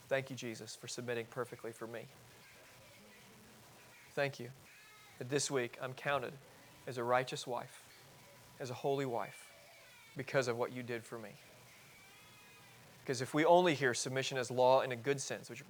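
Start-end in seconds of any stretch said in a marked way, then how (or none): 0.1-11.3 soft, unhurried words of thanks; hushed, reflective gratitude; quiet thankful prayer. Acted. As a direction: pray it gently, with pauses between phrases.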